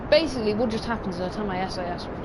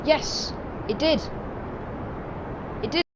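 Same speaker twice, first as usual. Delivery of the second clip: excited cheer; 7 semitones higher than usual. Real, not acted. Launch succeeds, cheering.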